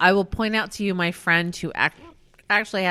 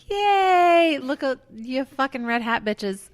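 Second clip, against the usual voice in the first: mock cheer; 6 semitones higher, slower than usual. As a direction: cheer it up high, sarcastically.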